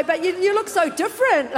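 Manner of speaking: loud, high, breaking into laughter; amused amazement. Retold or imitated. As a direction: exclaim it while starting to laugh. Laughing retold reaction to Freddie.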